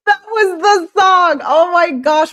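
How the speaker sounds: high, loud, half-crying with joy; thrilled shout. Real, not acted. Streamer overjoyed when chat finds the song, shouting. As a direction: burst out in relief.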